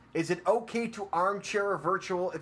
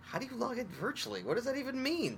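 much higher, disbelieving question; incredulous; 10 semitones higher, 5 dB quieter than usual. Real, not acted. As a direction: ask it in stunned disbelief.

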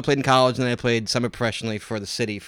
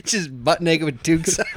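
laughing; 5 semitones higher than usual. Real, not acted. Laughing at the toque joke.

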